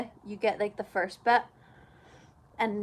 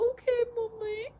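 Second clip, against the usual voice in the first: high, softer, tearful little voice; weepy; 12 semitones higher, 6 dB quieter than usual. Retold or imitated. Weepy daughter agreeing with mum, small and high. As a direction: imitate a soppy child giving in. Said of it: "I was so weepy".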